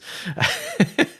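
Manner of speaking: a real laugh that jumps way up; high laugh. Real, not acted. Host laughs at his own accent sounding Canadian. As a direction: laugh at yourself.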